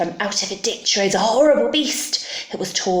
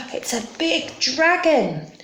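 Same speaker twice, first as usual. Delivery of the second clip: gasp, then high-pitched alarm; mock frightened gasp; 7 semitones higher than usual. Storyteller spots the dragon, gasping high mock alarm. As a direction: play scared for young listeners.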